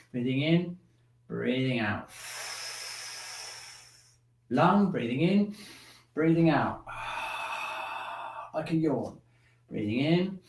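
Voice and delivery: slow, soft breathing cues; guided breathing. Shown as a demonstration. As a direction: lead the breath gently, drawn out.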